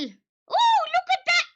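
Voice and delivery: high, bright excited gushing over the tea; thrilled little squeals of joy; delighted squeal. Acted. Guest thanking the lady for tea, gushing high and excited.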